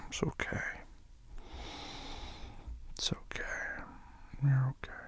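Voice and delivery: hushed, breathy reassurance; soft whisper. Acted. Comforting his upset partner in a soft whisper. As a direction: whisper it close, to calm them.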